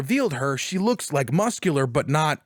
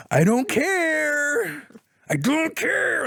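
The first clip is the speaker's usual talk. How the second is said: gleeful indifference; 11 semitones higher, much slower than usual. Retold or imitated. Gloating over character's death.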